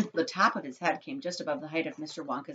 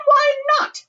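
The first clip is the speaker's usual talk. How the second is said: very high, loud, stretched challenge; shrieking; 13 dB louder than usual. A narrator's reading. Mother demands reason from chocolate maker, shrieking. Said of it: "shrieked Missus Gloop".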